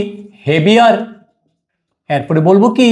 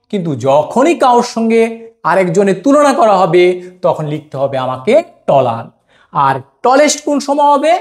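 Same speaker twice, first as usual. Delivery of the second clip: usual speaking voice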